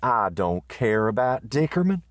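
high, easy grumble; Riata, drowsy and unbothered; sleepy indifference. Acted. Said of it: "said Riata".

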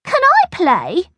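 put-on very high, drawn-out child voice; slow, high-pitched girlish question; eager little girl. A narrator's reading. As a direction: play a little girl asking to join in. Angela asks to join the game.

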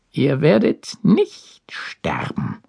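cold hissing lizard voice; high, slow unvoiced hiss; hissing snake. A narrator's reading. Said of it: "zischte die Schlange".

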